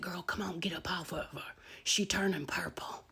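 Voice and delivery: hushed bystander imitation; urgent whisper. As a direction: whisper it, coaxing her to let go. Bystander urging her, voiced in whisper.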